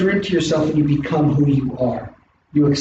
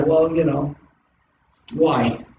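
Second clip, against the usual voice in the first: ordinary talk, nothing marked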